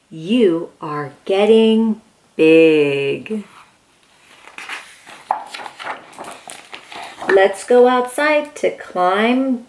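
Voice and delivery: storybook reading. Acted. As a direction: read it gently like bedtime to a toddler. Reads picture book aloud.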